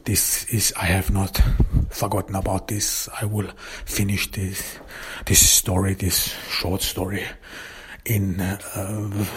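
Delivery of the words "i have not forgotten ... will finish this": usual speaking voice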